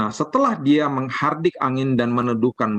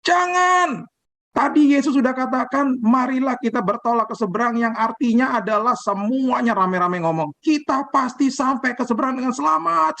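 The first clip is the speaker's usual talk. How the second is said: high shouted protest; group shouting back; 10 semitones higher than usual. Retold or imitated. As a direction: shout them down together, pitched high. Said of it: "semuanya berteriak lagi".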